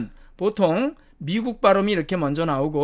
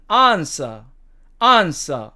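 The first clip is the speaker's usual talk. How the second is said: British "answer", long ah and silent r; British demo of "answer", r dropped; British accent; 4 dB louder, much slower than usual. Shown as a demonstration. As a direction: say "ahn-suh" for "answer", British style. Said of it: "영국식 발음으로는".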